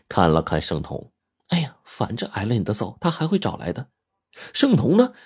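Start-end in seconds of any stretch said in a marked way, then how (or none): 1.5-3.9 soft, lightly chiding wife; mild reproach. A narrator's reading. Xiaoqin to Shengtong after the punch, mild reproach. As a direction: chide him softly, rising at the end. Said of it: "小琴用嗔怪的眼光".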